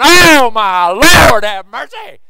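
mock a fan melting at steel guitar; swooning country fan. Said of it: "people going".

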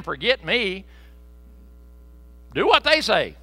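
loud, high, fervent revival preaching; preaching at full force. Acted. Pastor retelling Roger's prayer to the congregation, loud and fervent. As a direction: shout the testimony to the church.

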